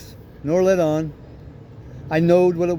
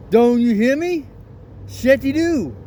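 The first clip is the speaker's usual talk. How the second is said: louder, higher repeated order; mighty loud; 5 semitones higher than usual. Retold or imitated. Father repeating the order to daughter who ignores him, loud. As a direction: raise the voice at a child ignoring you. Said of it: "mighty loud".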